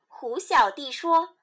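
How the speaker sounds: ordinary delivery, nothing marked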